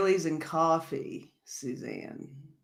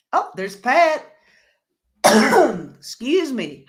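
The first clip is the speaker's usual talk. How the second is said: ordinary talk, nothing marked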